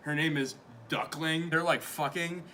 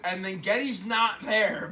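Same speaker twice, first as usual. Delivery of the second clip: ordinary delivery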